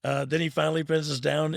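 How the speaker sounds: ordinary delivery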